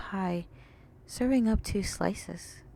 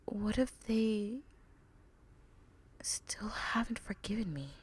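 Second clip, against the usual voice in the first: put-on soft, sighing, worried young mare; softer voice, guilty and afraid of not being forgiven; Ruby, remorseful; 6 dB quieter than usual.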